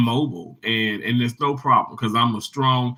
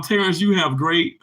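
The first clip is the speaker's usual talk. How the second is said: ordinary delivery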